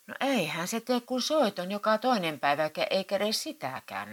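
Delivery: wife needling her grumpy husband back; nagging retort. A narrator's reading. Wife nags back at grumpy Matti, lightly voiced. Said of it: "naputti Vieno".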